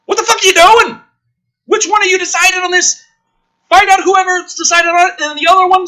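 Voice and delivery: high, loud scolding of the writers; furious shouted tirade; angry yelling. Real, not acted. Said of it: "I spent so long yelling".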